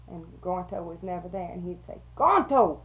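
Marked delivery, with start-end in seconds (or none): 2.2-2.8 louder roll call, name sung out; retold teacher calling the roll. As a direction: call the absent student's name. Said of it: "like that".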